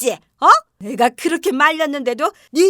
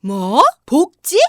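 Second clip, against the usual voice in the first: shocked; 7 semitones lower, much slower than usual. Acted. Mother-in-law hears of return to work.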